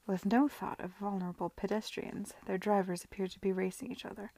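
ordinary delivery

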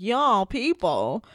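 drawn-out, mock-affronted repeat; affronted echo. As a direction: echo it slowly as if taking offense.

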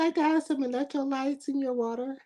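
high, soft mock-gracious offer; fake polite voice. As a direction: offer it sweetly in a put-on high, quiet voice. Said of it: "that fake ass voice".

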